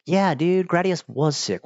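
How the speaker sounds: real fanboy enthusiasm; enthusiastic agreement. Real, not acted. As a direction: agree excitedly, like a fellow fan. Enthusiastic agreement with repeated remark.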